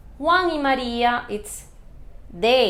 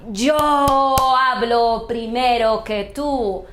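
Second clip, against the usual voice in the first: slow and louder, every word weighted; emphatic example; 5 dB louder, slower than usual. Shown as a demonstration. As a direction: lean on "Yo" to show emphasis. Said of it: "if you want to emphasize".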